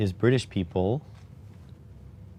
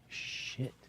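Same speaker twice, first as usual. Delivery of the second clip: whispered curse; 16 dB quieter than usual. Real, not acted. Noticing the late time.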